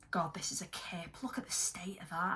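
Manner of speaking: unvoiced whisper of mock shame; whispered disgust. Real, not acted. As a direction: hiss it under your breath at the palette.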